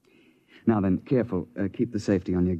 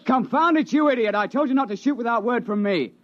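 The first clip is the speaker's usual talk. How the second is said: angry rebuke; 13 semitones higher than usual. Acted. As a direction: tear into him for firing without orders. Guide scolds hunter for shooting.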